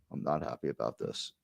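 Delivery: low, hushed grumble; unhappy aside, dropped right down; glum aside. Real, not acted. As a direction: mutter it very low and soft. Grumbled aside before the clip.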